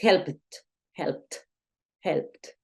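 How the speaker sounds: "helped" as one syllable, "helpt"; strong final T. Shown as a demonstration. Teacher shows the stronger sound after P, strong final T. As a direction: hit a crisp T at the end of "helped". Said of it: "Los sonidos que son más fuertes".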